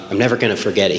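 normal talking voice

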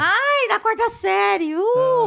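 high, drawn-out mock squeal; mock gushing. Mocks reunions with old schoolmates.

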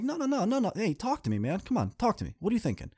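high, pleading no-no-no; urgent coaxing. Retold or imitated. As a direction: pull her back from quitting.

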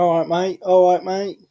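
hammed-up Australian accent. Shown as a demonstration. Co-host insisting on his accent, mock Australian. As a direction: put on a cartoonish Aussie greeting. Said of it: "It's an Australian accent".

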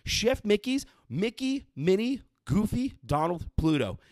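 high, bright-eyed listing of the characters; excited hype. Real, not acted. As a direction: sell the lineup like a theme park barker. Hyping up the character lineup.

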